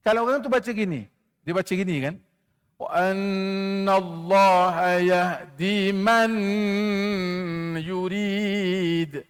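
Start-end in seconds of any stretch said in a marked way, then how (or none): 2.7-9.2 slow chanted recitation, nun of man kept clear as izhar; wrong reading shown. Shown as a demonstration. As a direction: recite it wrongly on purpose, slowly. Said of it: "Bacaan dia salah tu". (bacaan salah, nun dibaca jelas)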